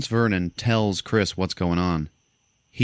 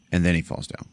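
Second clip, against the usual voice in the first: ordinary delivery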